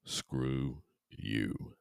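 ordinary delivery

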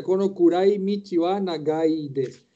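Kono kurai michi wa nagai desu said slowly and carefully; slow model reading. Shown as a demonstration. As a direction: read it out slowly for the class. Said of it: "la última es clara".

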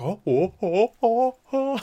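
high, startled oh oh oh; hammed-up confusion at an empty car; mock baffled oh-ing. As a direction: play a raging driver caught off guard. Driver finds no driver.

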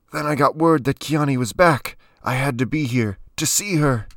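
high, urgent, strained account; desperate high-pitched explanation; strained pleading. A narrator's reading. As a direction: plead your case while pinned by the throat. Pinned man pleading his case.

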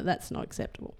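breathy hushed aside under the breath; whispered aside. Real, not acted. Mum grumbling to her son about Insta's rules, whispered. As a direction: let it drop to a whisper.

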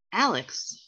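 ordinary delivery

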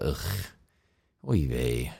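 put-on groaning low oy vey, Yiddish accent; weary Yiddish groan.

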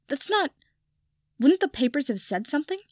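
high, rising disbelief; stunned disbelief. Acted. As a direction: sputter in disbelief. Draco stunned by news.

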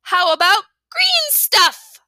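put-on loud, slow, high kid call-out; shouted. A narrator's reading. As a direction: blurt it across the room. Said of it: "Fudge shouted".

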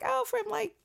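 high-pitched mock Irish accent. Roommate back from abroad answering where she's from, mock Irish accent. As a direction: put on a high, teasing Irish lilt. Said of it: "she had, like, an Irish accent".

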